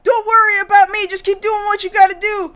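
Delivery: high-pitched, rushed hostage voice; imitation of an urgent captive woman; brave captive. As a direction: plead fast and high, urging them on. Acting captive Miki on walkie.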